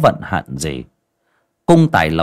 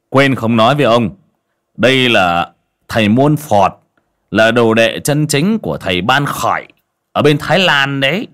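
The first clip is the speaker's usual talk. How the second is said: boastful hype; 5 semitones higher, 4 dB louder than usual. A narrator's reading. Scarred man hyping his Thai master to the old man, loud sing-song bragging. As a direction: sell the sorcerer like a market hawker.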